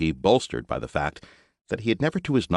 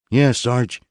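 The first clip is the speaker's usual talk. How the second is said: higher, louder eager trooper voice; young legionnaire; 8 semitones higher, 7 dB louder than usual. A narrator's reading. Double X boasting to his sergeant, high and eager. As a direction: brag like a keen young grunt.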